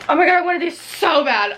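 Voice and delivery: excited. Real, not acted. Sees wanted books.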